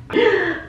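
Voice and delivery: high gasp of mock shock. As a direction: gasp sharply as if stunned. Retold shock at the money.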